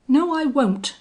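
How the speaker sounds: high, loud, blunt defiance; blunt and forceful; blunt refusal. A narrator's reading. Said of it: "replied Belllounds bluntly".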